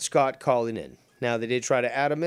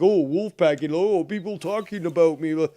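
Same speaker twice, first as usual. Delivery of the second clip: mocking whine; 4 semitones higher, 4 dB louder than usual. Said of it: "What is this about, like".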